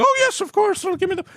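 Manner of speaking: high-pitched, gushing acceptance; hammed-up squeal of delight; mock eagerness. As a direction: play an over-keen taker, voice shooting way up. Mock eager acceptance of middle seat.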